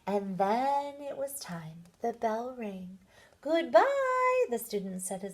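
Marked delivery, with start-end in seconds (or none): 3.4-4.5 high, loud called-out goodbye; calling goodbye. Acted. Students rushing out the door call goodbye to their teacher, loud and high. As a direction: shout it across the room as you run.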